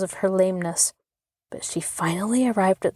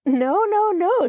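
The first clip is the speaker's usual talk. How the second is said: much higher and louder playful no; teasing refusal; 11 semitones higher, 4 dB louder, slower than usual. A narrator's reading. Rosie teasing the curious children, playful high refusal. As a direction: refuse with a gleeful sing-song. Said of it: "she maintained".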